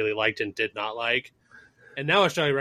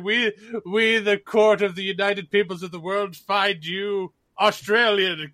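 higher, hammed-up judge handing down a grand sentence; mock court verdict; 6 semitones higher, 3 dB louder, slower than usual. Joking about Australian sentencing, hammed-up world court verdict. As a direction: proclaim it like a pompous world court, slowing at the end. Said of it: "they're just like".

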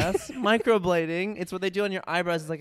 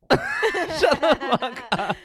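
laughing; 8 semitones higher, much slower than usual. Real, not acted. Laughing playful insult.

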